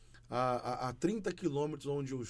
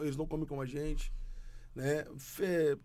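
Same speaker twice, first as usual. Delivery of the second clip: ordinary delivery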